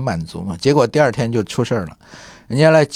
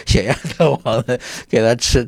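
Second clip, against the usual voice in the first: ordinary delivery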